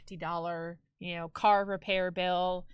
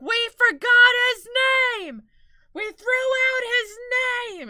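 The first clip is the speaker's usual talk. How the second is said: panicked SpongeBob scream; 14 semitones higher, 13 dB louder than usual. Retold or imitated. SpongeBob with everything on fire, screaming in panic. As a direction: scream it in a wailing cartoon panic. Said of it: "just, like, screaming".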